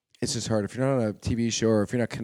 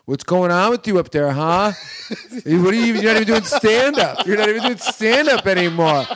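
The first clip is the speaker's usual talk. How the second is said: loud, high scolding boss; mock Tommy; 8 semitones higher, 7 dB louder than usual. Club owner scolding the comic, loud and high. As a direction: chew out the young comic. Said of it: "Tommy's like".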